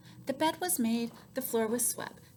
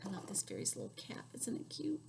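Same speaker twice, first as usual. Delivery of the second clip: whisper; 11 dB quieter than usual. Real, not acted. Aside to child, unvoiced.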